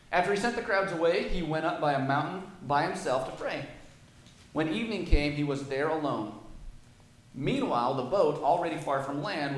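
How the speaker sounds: loud, measured Bible reading to the church; scripture reading. Acted. Preacher reading the gospel aloud to his church. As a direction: read the passage out with weight.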